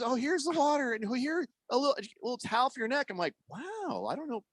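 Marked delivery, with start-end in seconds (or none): 0.0-3.1 put-on high-pitched fussing old lady; imitated old lady. Sandwich shop lady offering water to her driver, imitated old lady. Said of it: "the old lady, she's like". 3.5-4.4 high, soft wonder; amazed. Retold or imitated. Driver reacting to the couple's kindness, softly amazed.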